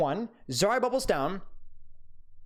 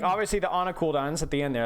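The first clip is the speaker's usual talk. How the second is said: normal talking voice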